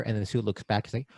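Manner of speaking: ordinary talk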